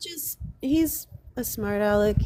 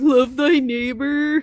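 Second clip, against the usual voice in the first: mock preacher; 4 semitones higher, 7 dB louder, slower than usual. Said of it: "he's like".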